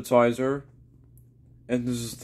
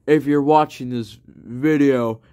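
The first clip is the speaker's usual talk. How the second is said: ordinary delivery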